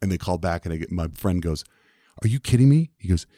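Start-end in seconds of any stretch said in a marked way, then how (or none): 2.2-2.9 loud, high-pitched disbelief; incredulous. Retold or imitated. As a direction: react as the exasperated, disbelieving friend. Friend reacts to the refusal.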